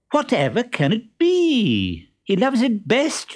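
high, exaggerated wondering for others to hear; very loudly. A narrator's reading. Columbine wonders aloud about the chest, meant to be overheard, very loudly. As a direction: make a show of wondering, high-pitched. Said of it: "very loudly".

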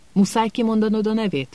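mild angry snap; understated irritated retort; angry interruption. A narrator's reading. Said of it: "vágott közbe dühösen Ron".

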